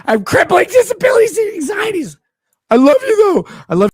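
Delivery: screeched high laughing mock voice; mocking the fan, yelled very high; mock shriek. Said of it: "They're like".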